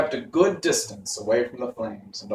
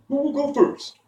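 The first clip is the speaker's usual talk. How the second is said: high, lightly voiced lion question; lion asking. A narrator's reading. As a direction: ask who goes first, uneasy.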